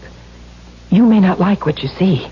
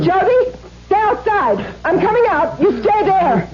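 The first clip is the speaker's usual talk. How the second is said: calling out; 10 semitones higher than usual. Acted. Calls to Jody outside.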